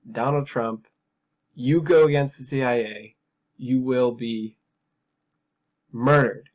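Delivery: loud, emphatic mock warning; voiced threat. Host voicing the CIA's threat to Donald Trump, menacingly. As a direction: spell out the threat, landing hard on the last word. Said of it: "That's a threat".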